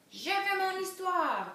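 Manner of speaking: high, loud, drawn-out demanding yell; princesse qui hurle. A narrator's reading. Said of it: "hurla la petite princesse". (hurlement de petite princesse exigeante)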